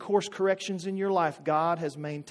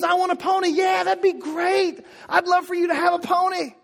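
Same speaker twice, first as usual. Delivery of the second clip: high, loud cheerful yes; excited dreaming; 11 semitones higher, 5 dB louder than usual. Retold or imitated. Parent cheering a kid's wish, high and excited. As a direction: gush over the idea. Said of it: "inspiring their dreams".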